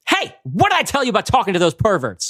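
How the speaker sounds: angry mother. Acted. Mother storms in on daughter.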